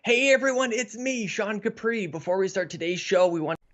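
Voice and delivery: bright, high show-opener voice; upbeat host intro. Acted. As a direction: greet the audience like a host.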